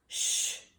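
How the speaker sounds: sharp, loud unvoiced shush; hissed shush. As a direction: hush him urgently. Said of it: "hissed Professor McGonagall".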